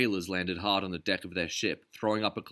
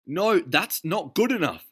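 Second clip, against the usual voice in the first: slow, higher, louder retort; shouting; 11 semitones higher, 8 dB louder than usual. A narrator's reading. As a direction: shout back in frustration. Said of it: "Derville shouted".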